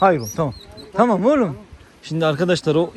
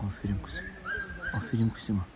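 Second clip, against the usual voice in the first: much softer, lower murmur of praise; 5 semitones lower, 12 dB quieter than usual. Real, not acted. Whispering praise to the dog. (köpeği fısıltıyla övme)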